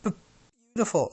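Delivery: shy stammered sigh; bashful sigh. Acted. Bashful shyly sighs over the girl. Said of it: "sighed Bashful".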